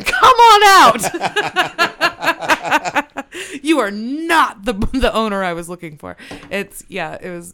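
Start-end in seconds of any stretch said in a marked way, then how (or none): 0.0-2.2 stretched out slowly through laughter; laughing summons. Retold or imitated. 2.3-6.2 loud, laughing, much higher mock shout; gleeful mock call-out. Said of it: "I'm like".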